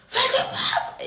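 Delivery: very high, laughing mock screech; hammed-up shocked fury; outraged stranger. Vlogger imitating the woman's outrage, very high and laughing.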